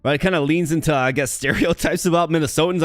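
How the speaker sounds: normal talking voice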